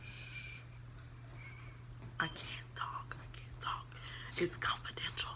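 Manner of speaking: very soft, voiceless retold shushing; imitated secretive whisper. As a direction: hush them in a bare whisper. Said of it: "All that fucking whispering and shit".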